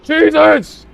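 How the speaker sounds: high, loud sudden yelp; startled shout. Real, not acted. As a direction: jolt and shout in surprise. Sudden in-game shock.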